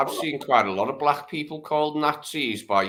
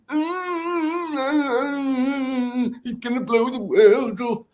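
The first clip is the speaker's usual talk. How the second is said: high, whiny mock wail of doom; hammed-up high doom whimper; mocking doom-monger; slower than usual. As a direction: mimic a panicky prophet of doom, pitched way up. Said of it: "a lot of Cassandras that come out".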